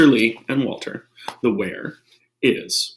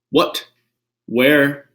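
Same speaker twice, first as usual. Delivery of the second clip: normal talking voice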